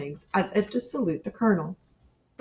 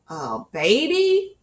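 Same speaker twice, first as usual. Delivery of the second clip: slow, higher annoyed question; 6 semitones higher, 4 dB louder, slower than usual. Acted. Child Cole to the narrator, slow annoyed question. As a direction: sound put out by the news.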